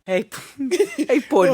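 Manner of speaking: lightly voiced hungover dad. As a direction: greet the child while hungover. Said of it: "he's like".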